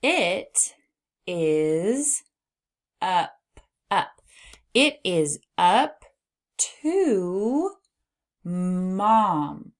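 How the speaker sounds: each word drawn out, pauses between; word-by-word reading. Shown as a demonstration. Teacher models reading for young learners, word by word. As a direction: sound it out slowly for beginners. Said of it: "All right, let's read".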